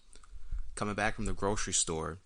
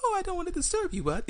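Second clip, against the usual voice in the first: put-on high, sing-song Swedish accent; mock gentle Swedish accent; faster than usual. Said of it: "like, Swedish person".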